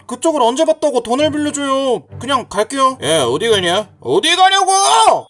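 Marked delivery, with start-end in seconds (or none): 3.0-3.9 low-pitched bully challenge; low menace. Acted. Bully stops a passer-by. 4.0-5.3 higher, drawn-out bully yell; angry yell. Acted. Bully repeats it, angrier.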